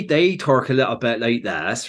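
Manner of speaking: West Country Bristol lilt; Bristol and Bath accent. Shown as a demonstration. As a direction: talk like someone from Bristol. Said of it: "you got, like, the Bristol Bath".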